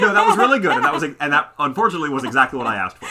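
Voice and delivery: high, loud, laughing through the words. Real, not acted. Husband to wife after her too-literal example, laughing. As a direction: crack up at how literal she was.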